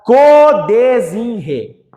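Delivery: CODESINRE stretched out syllable by syllable; drawn-out mnemonic. Shown as a demonstration. Teacher drilling prefixes for the class, drawn-out syllables. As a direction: chant out "codesinre" very slowly, each part separate.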